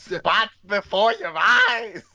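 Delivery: high, drawn-out mock-excited announcement; over-the-top, high-pitched title read; hammed-up title reveal. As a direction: blurt the title slowly in a high voice. Repeats the clue, hammed up.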